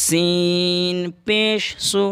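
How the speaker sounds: very slow, drawn-out syllables; teacher spells سو slowly; slow spelling. Shown as a demonstration. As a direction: spell سین پیش سو deliberately. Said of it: "سین کو جلدی پڑھ رہے ہیں".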